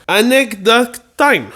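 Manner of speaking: announcer voice. Pretend. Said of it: "announces segment".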